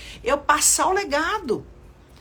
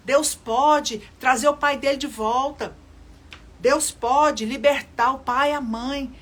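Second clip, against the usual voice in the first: slow, louder preaching declaration; 2 semitones higher, 5 dB louder than usual. Acted. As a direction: proclaim it slowly to the congregation.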